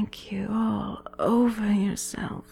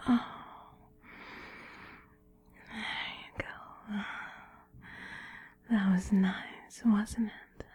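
pillow talk; 3 semitones lower, 13 dB quieter than usual. Acted. Gentle goodnight after the climax.

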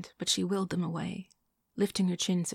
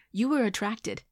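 louder, higher, cutting in to correct; harsh correction; 5 semitones higher, 6 dB louder than usual. A narrator's reading. Woman correcting her date in a restaurant, harsh. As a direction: correct him a little too harshly. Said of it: "maybe too harshly".

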